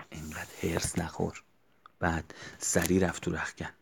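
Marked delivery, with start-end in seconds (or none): 0.1-1.4 soft, gentle reassurance; calm and smiling. Retold or imitated. Wrestler calming his furious friend, soft and gentle. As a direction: soothe your angry friend with a smile. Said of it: "خیلی آرام و با لبخند همیشگی گفت".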